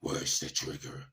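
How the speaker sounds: low, growled whispery Batman imitation. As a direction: growl it low like a masked vigilante. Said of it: "did the Batman voice".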